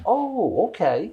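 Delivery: high, surprised "Oh" settling into "okay"; surprised approval. Retold or imitated. Listener hearing the finished song, pleasantly surprised. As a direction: pleasantly caught off guard, then won over. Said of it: "she says".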